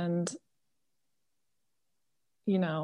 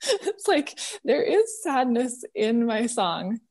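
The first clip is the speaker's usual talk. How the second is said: laughing through real tears; tearful laugh, voice pitched up; crying, worn out; 5 semitones higher, slower than usual. Real, not acted. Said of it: "now I can't stop crying".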